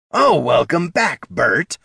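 high, loud, drawn-out mock welcome; sarcastic pantomime. A narrator's reading. Kobold mocking Gorm's missing welcome, sarcastic pantomime. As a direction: play out a sarcastic greeting in kobold voice. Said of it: "the kobold pantomimed".

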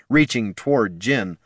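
ordinary delivery, nothing marked

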